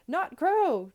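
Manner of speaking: high, loud gleeful call. A narrator's reading. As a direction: call out to a bird. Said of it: "I called to the tiny bird".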